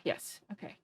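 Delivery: quiet whispered aside. Real, not acted. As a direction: breathe it out under your breath. Unvoiced aside.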